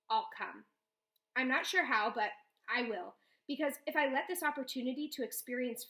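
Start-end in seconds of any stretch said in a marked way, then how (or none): none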